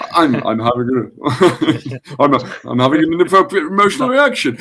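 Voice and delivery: lightly voiced charming self-talk, laughing through it; normal charming voice. Shown as a demonstration. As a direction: say it in your easy, charming everyday voice. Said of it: "I use my normal, charming voice".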